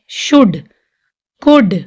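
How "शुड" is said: शुड said with no L sound; silent L. Shown as a demonstration. Teacher correcting the mistake, शुड with silent L. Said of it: "इसको हम शुल्ड नहीं बोलेंगे".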